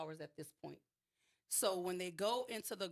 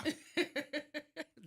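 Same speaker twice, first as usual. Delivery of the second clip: higher-pitched burst of laughter; amused laugh; 8 semitones higher, 3 dB louder than usual. Real, not acted. Storyteller reacting to the lieutenant getting her complaint, laughing. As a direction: laugh at the irony.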